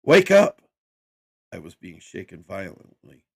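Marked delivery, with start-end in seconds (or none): 0.0-0.6 high, loud panicked wake-up yell; urgent shout. A narrator's reading. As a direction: scream him awake. Wife shaking him awake, fire.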